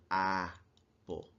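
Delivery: "apple" with a drawn-out short A, not "ay"; slow, loud short-vowel demo on "apple". Shown as a demonstration. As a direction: isolate and lean on the opening vowel of "apple". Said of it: "the sound of the short vowel is".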